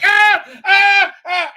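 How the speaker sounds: high, loud mock wail. Streamer imitating propagandists crying foul, mock wailing. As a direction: cry out in exaggerated outrage. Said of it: "and they're like".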